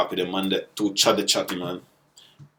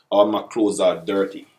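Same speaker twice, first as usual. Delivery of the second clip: ordinary delivery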